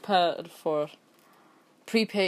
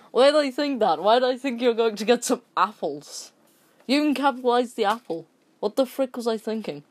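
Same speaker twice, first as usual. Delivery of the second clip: mock disbelief; 6 semitones higher, 5 dB louder than usual. Real, not acted. Mocking himself over apples.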